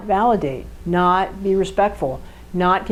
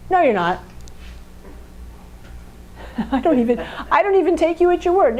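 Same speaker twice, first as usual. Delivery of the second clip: dismissive fact person; 8 semitones higher, faster than usual. Acted.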